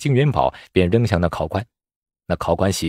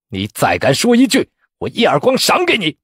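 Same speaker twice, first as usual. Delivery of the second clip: irritated threat climbing high; angry scolding; 7 semitones higher, 3 dB louder than usual. A narrator's reading. Vexed examiner threatening the shouting candidate, angry. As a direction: threaten him with rising temper. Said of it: "当即骂道".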